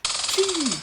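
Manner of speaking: high, loud squirrel squeal; shrill out a red squirrel's cry; sudden piercing animal cry, very high-pitched; squirrel chatter. A narrator's reading. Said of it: "clattered a red squirrel".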